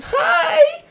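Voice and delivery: very high, loud squealed hello; squeaky mock greeting. Woman waiting at the door greets her date, mock high-pitched squeal. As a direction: imitate an over-eager greeting way up high.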